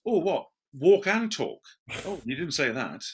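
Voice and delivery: high, startled "what?"; flustered surprise. Retold or imitated. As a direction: brain caught off guard, flustered. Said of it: "your brain goes".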